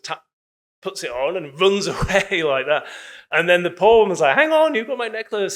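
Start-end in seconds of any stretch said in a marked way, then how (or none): 4.4-5.5 mock indignant protest. Poor woman protests in story.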